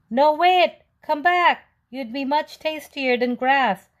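high, loud calls; calling after. Acted. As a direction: shout after the runaway cookie. Animals calling after cookie.

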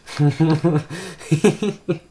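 real burst of laughter; laughing hard at own outburst; loud high laughter. Real, not acted. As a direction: crack up at yourself.

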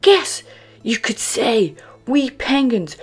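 high, loud, proud little penguin; Rowley tells Santa how penguins fly; Rowley's voice. Acted. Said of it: "Rowley cried".

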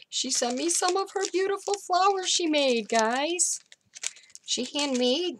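high, thrilled gushing; excited squeal. Real, not acted. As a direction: squeal with delight over a gift. Thrilled over handmade flowers.

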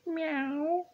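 very high, thin kitty voice; cat's meow. A narrator's reading. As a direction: mimic a contented cat meowing. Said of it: "purred Pilchard".

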